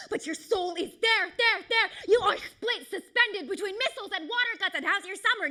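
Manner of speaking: high, loud slam-poetry climax; impassioned shouted verse; anguished outcry. Acted. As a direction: cry it out at full intensity. Poem reaches its anguished peak.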